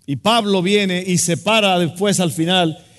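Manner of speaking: fervent storytelling sermon; preaching at full force. Acted. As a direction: paint the scene for the congregation.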